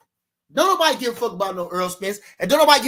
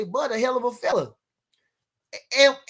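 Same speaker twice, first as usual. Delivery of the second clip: ordinary talk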